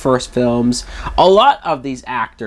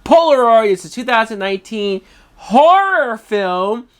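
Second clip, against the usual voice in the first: horror said high and pushed; stress on horror; 8 semitones higher than usual. Real, not acted. Reviewer scorning a horror film, stressing horror. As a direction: lean hard on the word horror. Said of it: "and I emphasize the word horror".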